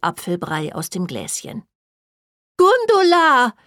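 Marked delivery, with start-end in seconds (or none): 2.6-3.5 high, loud, drawn-out call; excited shout that falls at the end. Acted. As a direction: holler the name across the room. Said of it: "rief Rose".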